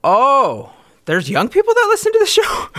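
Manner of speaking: high, exaggerated disbelief; mock surprise. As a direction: act shocked, high and loud. Mock surprise at young listeners.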